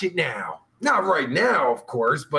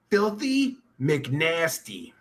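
ordinary talk, nothing marked

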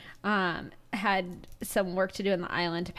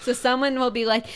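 ordinary talk, nothing marked

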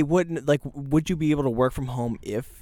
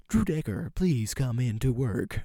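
slow, soft automated recording; plastic voice; 8 dB quieter, slower than usual. Retold or imitated. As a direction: read it like a robocall message. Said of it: "a message that says".

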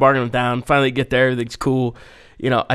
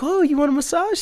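much higher sing-song mock offer; coy masseuse; 14 semitones higher than usual. Masseuse approaching Steve on the street, high mock offer. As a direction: imitate a sly street masseuse.

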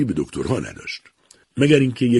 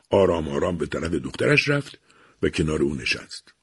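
ordinary delivery

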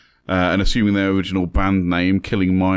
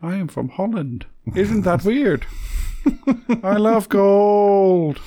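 high, slow put-on Dutch villain voice; Goldmember; 11 semitones higher, much slower than usual. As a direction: drag out the words in a silly Dutch accent. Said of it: "That's from Goldmember".

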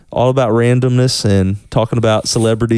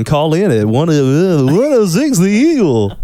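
higher, slow radio DJ call-in; 5 semitones higher, slower than usual. Pretend. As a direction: plug the station like a DJ.

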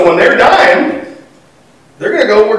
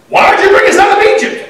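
high whiny mock complaint, pitched way up; mock grumbling complaint; 12 semitones higher than usual. Preacher mocking Israelites grumbling at Moses, high whiny voice. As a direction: whine it like a griping kid who never left home.

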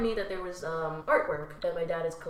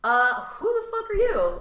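retold indignant challenge, higher and louder; 11 semitones higher, 7 dB louder than usual. Host recounts confronting a rude party guest, indignant. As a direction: throw it back in mock outrage, pitched up.

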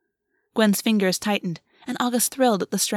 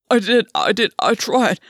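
louder, pleading self-defence; desperate protest; 5 dB louder than usual. Acted. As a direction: protest desperately, repeating yourself. Gwen defends himself, shaking head rapidly.

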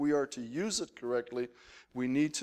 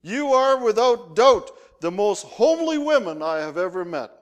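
thundered; 9 semitones higher, 10 dB louder than usual. Retold or imitated. Homesick missionary thanking retired lady missionaries, thundering with enthusiasm. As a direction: proclaim it like a big compliment. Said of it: "I thundered".

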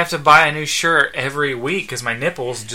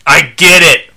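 fed-up raised voice; cutting in; 2 semitones higher, 11 dB louder than usual. Acted. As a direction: cut them off sharply. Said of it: "Michael cut him off".

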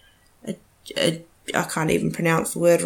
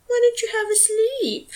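talking to the dog. Real, not acted. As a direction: coo gently to a small dog. Soothing the hiccuping dog.

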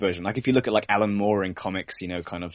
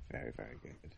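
much softer repeat of Very, very good; fading to near silence; 8 semitones lower, 21 dB quieter, faster than usual. Real, not acted. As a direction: let Very, very good trail off under the breath.

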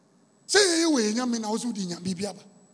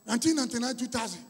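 ordinary talk

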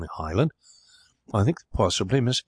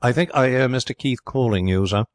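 higher, louder butler's voice, innocently interrupting; sly butler; 4 semitones higher, 3 dB louder than usual. Acted. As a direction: put on the servant's coaxing tone. Butler Keggs interrupts his master.

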